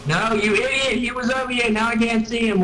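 high-pitched annoyance; exasperated scolding. Real, not acted. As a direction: tell off a useless teammate. Scolding teammate mid-game.

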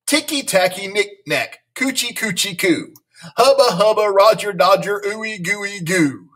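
blurted out. A narrator's reading. Lulu trying to close the word warp, playful high blurting. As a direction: blurt it high, stretching the last words. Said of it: "stood right up and blurted out".

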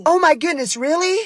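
very high, breathless disbelief; amazed mom. Acted. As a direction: gasp in delighted shock. Mom shocked at news.

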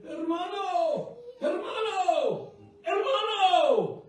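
high, long-drawn holler down the road; imitated hollering. Woman screaming to the preacher from blocks away, high drawn-out holler. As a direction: cry it out high, stretching every word. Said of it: "she's just screaming".

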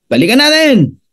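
usual speaking voice

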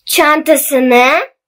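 slow, word by word with long pauses; dictating. Shown as a demonstration. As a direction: read it out slowly for pupils to write down. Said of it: "Ben metni yavaş yavaş okuyacağım".

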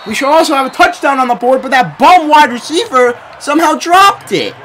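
heated shouting rant; angry rant. Real, not acted. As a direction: vent at the receiver. Ranting about dropped touchdown.

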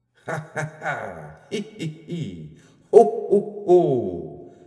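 slow, high ha ha, hi hi, then a huge booming ho ho; a giant troll king cackling over his catch; troll king laughing. Acted. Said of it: "lo troldkongen".

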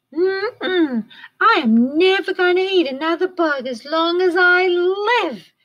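high, loud gleeful bat voice; cheering. A narrator's reading. Stellaluna cheering at the mango tree, high and gleeful. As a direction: squeal it out with delight. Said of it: "cheered Stellaluna".

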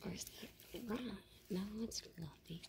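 hushed, unvoiced whisper. Real, not acted. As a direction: whisper it so the animal stays calm. Whispering so as not to scare it.

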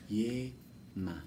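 Ye and ma said apart with a pause; syllables split. Shown as a demonstration. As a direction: break "Yema" into Ye, ma. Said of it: "teacher splits syllables". (sílabas separadas con pausa)

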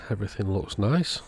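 ordinary talk

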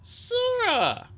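high, subdued single-word answer; sad. A narrator's reading. Clay gives his sister's name to the queen, sad. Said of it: "so sad".

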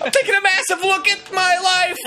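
high, desperate comic yell; panicked confession shouted through the door; super frantic. Acted. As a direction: wail it high in mock crisis. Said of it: "Super frantic".

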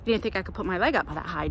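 ordinary talk

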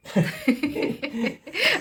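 high giggle; real light laughter; laughing. Real, not acted. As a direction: giggle for a second or two. Laughs at the snack moment.

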